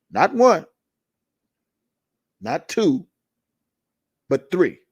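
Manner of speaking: slow emphatic count, a pause after each; stress each number. Real, not acted. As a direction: hammer not one, not two, but three.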